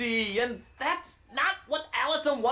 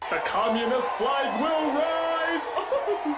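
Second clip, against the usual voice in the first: mock rallying cry; 5 semitones higher, much slower than usual.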